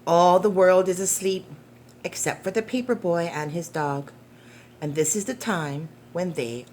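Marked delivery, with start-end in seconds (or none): none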